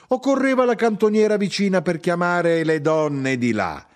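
high, insistent, indignant tirade; aggrieved wife railing on; heated grievance. Acted. Mother rants about husband's uselessness.

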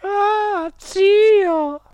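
very high, loud cry; exclaiming woman. A narrator's reading. Directress hearing her dead uncle, high exclamation. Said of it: "esclamò la signora Geltrude".

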